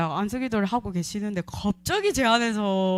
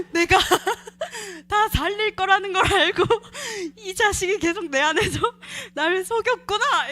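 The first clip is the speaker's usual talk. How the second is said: laughing through tears; 10 semitones higher, 4 dB louder than usual. Real, not acted. Laughing realization, near tears.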